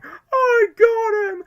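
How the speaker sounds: shrieking high villain triumph; manic, drawn-out high cry; Riddler. Retold or imitated. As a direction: screech it out, stretching every word. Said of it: "what the Riddler said by saying".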